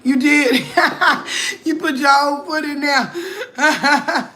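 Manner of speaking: laughing hard while talking; words breaking into laughter. Real, not acted. Laughing at a friend's comment.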